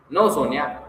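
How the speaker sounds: usual speaking voice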